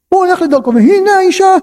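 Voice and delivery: high-pitched surprise at a sudden sight; startled discovery. Acted. Teacher retelling the husband finding her, surprised. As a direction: lift high on והנה, as if caught off guard. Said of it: "משהו מפתיע".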